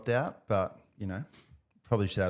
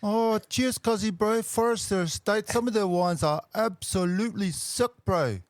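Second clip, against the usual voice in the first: mock Kiwi bro; 6 semitones higher than usual.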